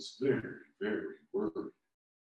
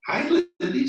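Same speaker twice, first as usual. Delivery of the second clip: high little squeak of a bug friend; tiny high squeaky greeting; 8 dB louder than usual. A narrator's reading. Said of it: "squeaked her friend Molly".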